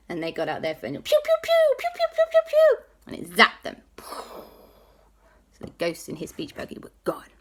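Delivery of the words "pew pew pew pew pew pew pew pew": very high, loud pew pew; rapid-fire ray gun sounds; laser zaps. Acted. Friends zapping ghosts.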